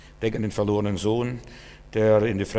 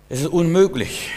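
emphatic shout; 6 semitones higher, 7 dB louder than usual. Real, not acted. Preacher proclaims emphatically.

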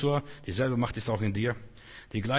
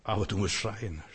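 usual speaking voice

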